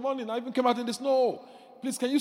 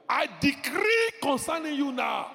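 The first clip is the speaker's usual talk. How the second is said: loud, high commanding decree; preaching at full force; 8 semitones higher, 3 dB louder than usual. Acted. Preacher commanding baggage out in Jesus' name, shouting. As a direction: thunder it over the congregation.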